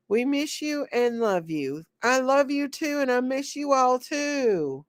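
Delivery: usual speaking voice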